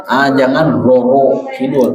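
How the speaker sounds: ordinary talk, nothing marked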